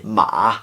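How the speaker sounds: "ma" dipping down and back up; third tone. Shown as a demonstration. Teacher previewing Mandarin tones, dipping third-tone "ma". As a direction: stretch "ma" through a dip in pitch. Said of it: "there's a dip in it".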